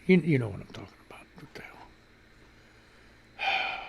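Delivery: fast low whisper; quick hushed aside. Real, not acted. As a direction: hush it, confiding to viewers.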